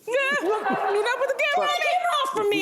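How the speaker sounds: laughing. Real, not acted. Embarrassed eating on camera.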